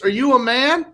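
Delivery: lightly voiced confrontational mechanic; imitated yelling mechanic. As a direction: imitate a mechanic challenging the customer. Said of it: "he goes like this".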